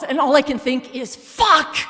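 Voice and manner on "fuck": sudden loud, high outburst of fear; a startled swear that breaks out loud; panicked curse. Retold or imitated. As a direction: blurt it out at full volume in terror. Charging bear, inner panic.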